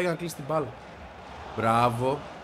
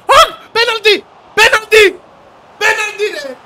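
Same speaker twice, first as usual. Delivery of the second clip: screaming penalty at the screen, high and loud; penalty yell; 14 dB louder, slower than usual. Real, not acted. Gamer screaming for a penalty at the screen. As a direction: yell it like the ref must hear you.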